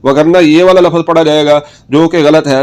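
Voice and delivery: ordinary talk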